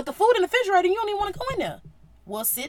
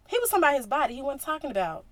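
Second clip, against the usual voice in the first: ordinary talk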